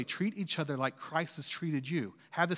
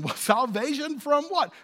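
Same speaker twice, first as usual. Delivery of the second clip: ordinary talk, nothing marked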